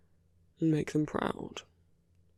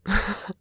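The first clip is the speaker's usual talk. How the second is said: quick, higher, louder chuckle; short laugh; 5 semitones higher, 5 dB louder than usual. Acted. Teasing the listener about deserving, short laugh. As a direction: let out a brief, amused laugh.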